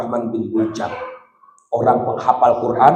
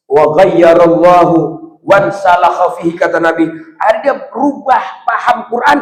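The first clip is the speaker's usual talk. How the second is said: high, loud sermon build; full-force preacher; fiery preaching; 7 semitones higher, 7 dB louder than usual. Acted. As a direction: preach it hard to the crowd. Preaching with raised voice.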